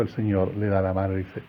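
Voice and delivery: usual speaking voice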